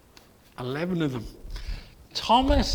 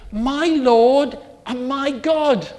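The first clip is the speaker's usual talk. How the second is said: louder and slow, full of wonder; awed confession; 6 semitones higher, 9 dB louder, slower than usual. Acted. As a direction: proclaim it slowly in awe.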